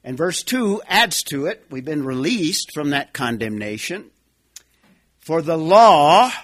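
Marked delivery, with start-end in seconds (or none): 5.2-6.3 "law" drawn out long and loud; stress on law. Shown as a demonstration. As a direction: lean hard and slowly on the word "law". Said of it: "I'm emphasizing the Greek word there".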